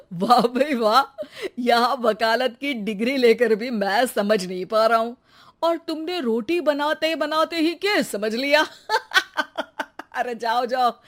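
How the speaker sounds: laughing scornfully through the words; mocking laughter. Husband mocks his wife's case advice, laughing scornfully. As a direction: laugh her idea off.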